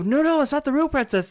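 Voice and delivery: high, insistent denial; guard disputing with other guard; arguing guard. Acted. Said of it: "And they go".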